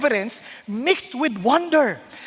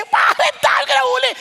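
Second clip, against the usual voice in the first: mock astonishment; 9 semitones higher, 4 dB louder than usual. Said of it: "Hindi ganun ang kanyang nasabi".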